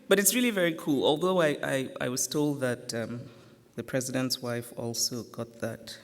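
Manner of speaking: usual speaking voice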